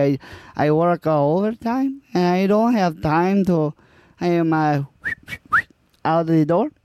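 light put-on Spanish accent. Improv scene as Juan.

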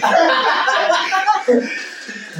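long, very high-pitched laugh; real laugh. Real, not acted. Joking among friends, laughter.

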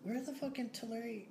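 high, soft incredulous question; surprised. Real, not acted. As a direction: ask in disbelief. Surprised at friend's hometown.

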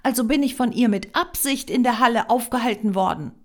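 loud, high-pitched accusation. A narrator's reading. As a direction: accuse him, outraged and loud. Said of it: "wenn Sie jetzt laut werden".

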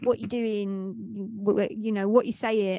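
mock half London, half Afrikaans slang; Aylesbury mixed accent. Imitating how locals in Aylesbury talk, London-Afrikaans accent. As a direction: toss off the local London-Afrikaans street slang, lightly mocking. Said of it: "half Afrikaans accent and a half London accent".

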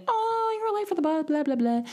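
sing-song mock scolding; annoyed bus passengers. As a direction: parody the irritated people on the bus. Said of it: "People are mad at me".

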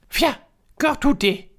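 high, bossy hen voice; a fussy hen nagging; hen's voice. A narrator's reading. Hen nagging the restless duckling, high and bossy. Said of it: "sagde hønen".

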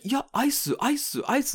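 put-on high, excited child insisting; eager kid voice. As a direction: play the eager student. Kids voiced in story.

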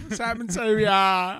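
loud, high, drawn-out imitation; slow, overacted loud wail; mock wail. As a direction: cry it out in hammed-up distress. Joining the hammy film impression.

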